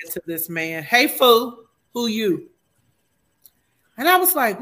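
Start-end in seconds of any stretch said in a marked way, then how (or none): 0.8-2.5 loud, blunt "Hey, fool, who you?"; mock tough greeting; rough callout. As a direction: bark it at a stranger. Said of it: "Not even a cool fool".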